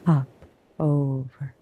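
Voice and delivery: plain reading voice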